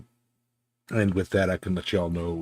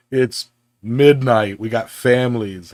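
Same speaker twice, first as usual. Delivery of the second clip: mock tired protest; 4 semitones higher, 8 dB louder than usual. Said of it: "everybody else is like".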